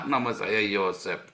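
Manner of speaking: real laugh over the name. Real, not acted. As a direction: laugh at how absurd it sounded. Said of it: "Saya ketawa kan". (tertawa geli sambil menyebut nama)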